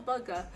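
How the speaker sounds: burger without the R, like "bugga"; own-accent burger. Retold or imitated. Student ordering food in line, burger pronounced "bugga". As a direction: say burger as "BUH-guh". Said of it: "What, you mean a burger?".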